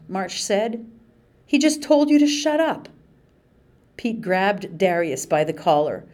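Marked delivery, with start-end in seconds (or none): 1.5-2.9 higher, louder indignant protest; heated protest. Acted. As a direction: defend your friend hotly. March protests to the guard.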